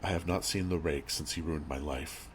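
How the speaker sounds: usual speaking voice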